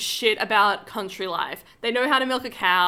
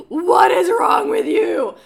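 outraged shout; 8 semitones higher, 8 dB louder, much slower than usual. Retold or imitated. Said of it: "I was like".

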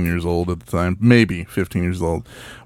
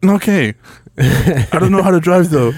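higher voice for a hesitant younger brother; reluctant kid brother; 7 semitones higher, 3 dB louder, slower than usual. Retold or imitated. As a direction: give in doubtfully, pitched up.

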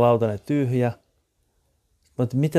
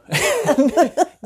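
high burst of laughter; 5 dB louder than usual. Real, not acted. Laughs at a joke.

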